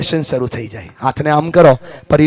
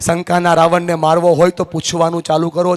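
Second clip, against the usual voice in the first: normal talking voice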